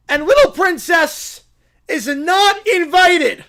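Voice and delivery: high, loud and theatrical; shouted proclamation. Acted. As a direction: proclaim it at full voice. Acted character bans the princess.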